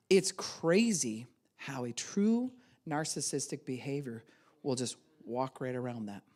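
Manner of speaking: ordinary talk, nothing marked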